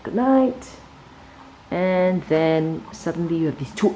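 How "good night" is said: animals saying goodnight. A narrator's reading. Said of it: "all the animals say".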